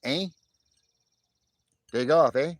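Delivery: loud put-on Canadian "eh"; hammed-up Canadian brush-off; Canadian accent. Shown as a demonstration. Streamer mocking his own "eh" habit, playful Canadian accent. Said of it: "every once in a while I will say".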